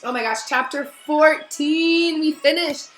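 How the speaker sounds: plain reading voice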